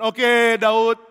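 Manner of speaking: high, loud, drawn-out concession; calling out. Acted. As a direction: call back across a distance. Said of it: "Saul mengatakan".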